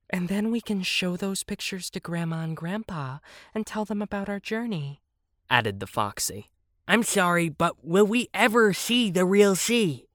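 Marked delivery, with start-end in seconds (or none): 0.1-5.0 high, soft little fox voice; gentle fox. Acted. Fox adds a plan for the grandparents, high and soft. 6.9-10.1 high, impatient question; impatient shark. A narrator's reading. Shark asks about seeing the sea, high and impatient. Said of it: "asked the sharky impatiently".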